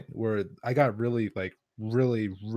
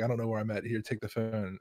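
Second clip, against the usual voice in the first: soft, trailing retold mumble; slurred mumbling; 3 semitones lower, 8 dB quieter than usual. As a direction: imitate giving up mid-mumble. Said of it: "or just mumbling".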